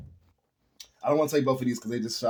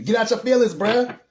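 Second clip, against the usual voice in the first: high, loud, no-nonsense push; blunt spirit voice; 10 semitones higher, 5 dB louder than usual. Retold or imitated. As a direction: snap them out of moping, loudly. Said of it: "It's telling me".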